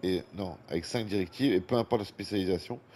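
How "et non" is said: normal talking voice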